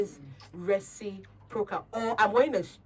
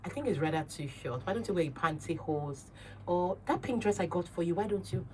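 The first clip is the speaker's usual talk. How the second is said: softer, gentle suggestion; soft persuading boyfriend; 7 dB quieter than usual. Retold or imitated. Boyfriend gently suggests a longer dress, softly. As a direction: coax her kindly about the dress. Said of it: "But if you now say".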